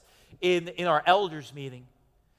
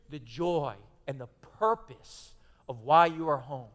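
ordinary talk

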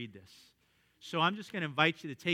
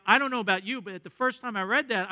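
normal talking voice

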